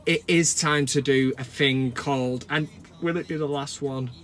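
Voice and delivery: ordinary talk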